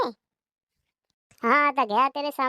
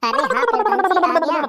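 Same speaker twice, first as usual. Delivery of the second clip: calling out; 5 semitones higher, 7 dB louder than usual. Acted. Greets the pradhan, calling out.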